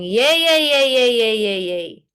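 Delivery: yay yay with a tense twang; twangy yay. Shown as a demonstration. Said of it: "Can you hear the difference that it's really a".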